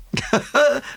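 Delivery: a high, hearty laugh. A narrator's reading. Poirot laughing heartily at Hastings' puzzlement. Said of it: "Poirot laughed heartily".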